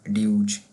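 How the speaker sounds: ड्यूच misread as "dyooch"; wrong pronunciation. Shown as a demonstration. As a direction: show the mistake: ड्यूच with a "yoo" vowel. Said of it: "करके नहीं पढ़ा". (ड्यूच, spelling जैसा ग़लत उच्चारण)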